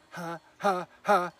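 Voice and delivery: loud tongue-out panting bursts; panting like a dog. Shown as a demonstration. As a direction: pant hard like a dog. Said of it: "panting like a dog".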